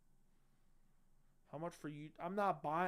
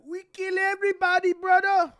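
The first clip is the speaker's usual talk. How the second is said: much higher, much louder battle yell; hype scream; 19 dB louder than usual. Pretend. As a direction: scream it like a fighter hyping the crowd. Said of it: "I'm like".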